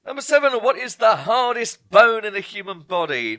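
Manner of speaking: loud, emphatic quiz question read-out; quizmaster announcing. Acted. Quiz host calling out the question, loud and emphatic. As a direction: boom it out to the room.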